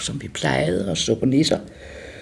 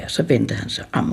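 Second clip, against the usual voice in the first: ordinary delivery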